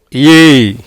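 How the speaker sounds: "ஏய்" lengthened on its own; long vowel demo. Shown as a demonstration. Teacher repeats the joined sound, "ஏய்" said long. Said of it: "நெடில்ல வருது".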